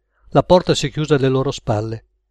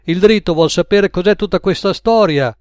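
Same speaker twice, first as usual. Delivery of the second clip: raised, higher voice relaying a question; calling through; 5 semitones higher, 4 dB louder than usual. Acted. Max to Al in the kitchen, mockingly calling out. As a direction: pitch it up to carry into the next room.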